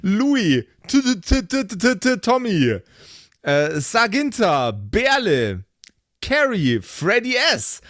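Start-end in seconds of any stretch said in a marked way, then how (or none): 0.9-2.8 playful stutter. Pretend. Stuttered name in supporter list.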